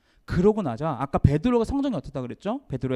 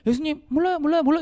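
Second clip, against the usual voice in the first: higher, petulant "I don't know"; whiny denial; 10 semitones higher, 3 dB louder, faster than usual. Retold or imitated. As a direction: brush it off like a sulky kid. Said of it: "이렇게 그냥 거부를 한 게 아니". (높고 투정 섞인 부인)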